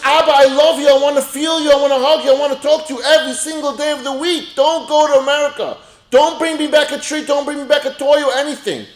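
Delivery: high, loud, insistent begging in a preacher's voice; impassioned plea. Acted. Child begging Abba not to travel, impassioned plea. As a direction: plead at full pitch, phrase piled on phrase.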